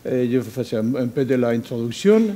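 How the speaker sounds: Spanish accent in French; French spoken with a Spanish accent; foreign accent in french. Real, not acted. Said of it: "Excusez-moi, mon, mon, mon français".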